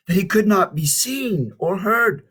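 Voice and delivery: ordinary delivery, nothing marked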